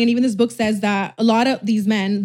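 ordinary talk

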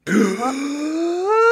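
long, high rising gasp; shocked gasp. Acted. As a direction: gasp at the big reveal. Shock at the name reveal.

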